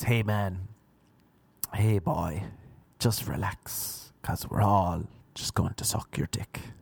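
put-on low, softened older-man voice; coaxing older man. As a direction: slowly coax him to relax, low and easy. Old man's coaxing proposition, acted.

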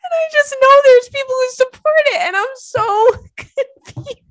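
very high, strained laugh-crying words; crying with laughter. Real, not acted. Host laugh-crying over people supporting it, very high. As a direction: squeeze the words out through tears of laughter. Laughing to tears mid-rant.

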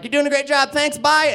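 high, loud parting praise; imitated vice principal. Vice principal leaves the stage, high loud send-off. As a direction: toss off a high-pitched mock goodbye.

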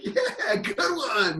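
high cheerful mock fan; laughing fans egging him on; fans approving. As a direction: toss off an amused yeah good one. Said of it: "They're like".

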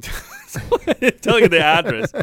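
words broken by laughter; giggling retelling the phone call; laughing through words. Real, not acted. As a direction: laugh as you finish the sentence.

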